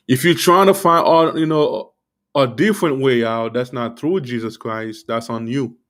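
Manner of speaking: talking with gum in mouth; words around a wad of gum; chewing gum. Real, not acted. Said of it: "I have, like, gum in my mouth".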